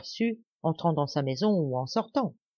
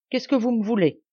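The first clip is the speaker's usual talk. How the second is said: curt, raised challenge; brusque interruption; 6 semitones higher, 4 dB louder than usual. A narrator's reading. The suspicious poacher cuts Blanche off, curt and rough. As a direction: snap the question back at her. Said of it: "interrompit-il brusquement".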